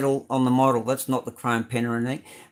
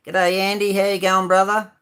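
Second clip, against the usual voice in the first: higher and louder hearty hello; cheery greeting; 7 semitones higher, 5 dB louder than usual. Real, not acted. Greeting viewer Andy in the live chat, loud cheery hello. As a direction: call out a big friendly g'day.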